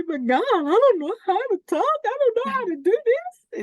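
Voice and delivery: high anxious objection to God; high tearful protest. Retold or imitated. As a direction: protest helplessly, near tears.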